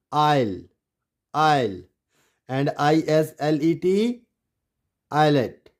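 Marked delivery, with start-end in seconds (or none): 0.1-1.8 आइल as eye-l; silent S. Shown as a demonstration. Teacher repeats the answer, silent S. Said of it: "क्या बना". 5.1-5.7 islet as EYE-let; silent S. Shown as a demonstration. Teacher gives the correct reading, silent S. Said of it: "it's not islet".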